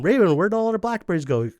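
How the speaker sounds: high, loud, demanding question; sisters' accusation. Retold or imitated. Sisters demanding where the blackberries went, high and loud. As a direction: confront him loudly.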